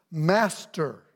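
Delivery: usual speaking voice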